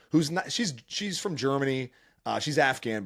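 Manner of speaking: normal talking voice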